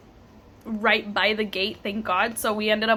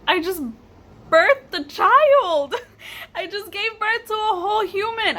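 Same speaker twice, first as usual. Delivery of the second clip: retold amazement; 9 semitones higher, 3 dB louder than usual. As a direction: marvel at it, voice climbing into a laugh. Amazed new mother retold.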